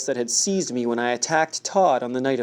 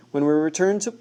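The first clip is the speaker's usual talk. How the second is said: ordinary delivery, nothing marked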